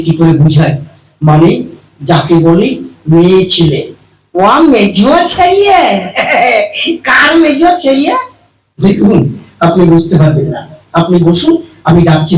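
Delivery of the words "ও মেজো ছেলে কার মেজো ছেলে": high silly voice. Acted. Comic mishearing, high-pitched reply.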